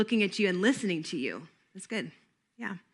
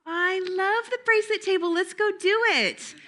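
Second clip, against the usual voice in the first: put-on much higher, eager kid voice; eager child; 9 semitones higher than usual. Shown as a demonstration. Teacher plays a camper at the bracelet table, eager. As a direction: play the excited camper choosing a table. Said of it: "role-play at the craft station".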